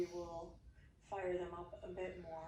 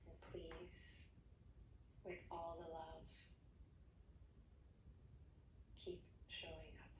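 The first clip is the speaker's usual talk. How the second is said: soft guided meditation; 8 dB quieter than usual. Acted. Yoga teacher ending class, hushed meditation voice. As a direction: settle them gently into rest. Closing relaxation guidance.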